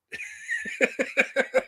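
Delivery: high burst of real laughter; laughing. Real, not acted. Fan cracking up at the dreadful dialogue, high laughing.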